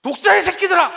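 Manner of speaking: high, loud furious shout; the prophet roaring at the Pharisees; fierce curse. Retold or imitated. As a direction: spit the insult with full force. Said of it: "쌍욕을 박아주시는 거예요".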